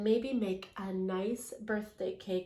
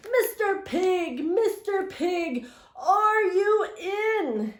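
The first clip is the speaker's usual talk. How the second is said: high, slow sing-song call; 7 semitones higher, 5 dB louder, slower than usual. Retold or imitated. Wolf calling at the stick house door in a high, drawn-out call. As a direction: call sweetly to the pig inside, stretching the words. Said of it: "I called".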